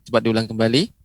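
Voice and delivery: ordinary talk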